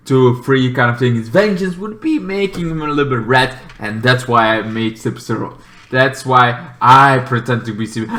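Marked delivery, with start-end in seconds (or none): none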